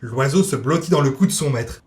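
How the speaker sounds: usual speaking voice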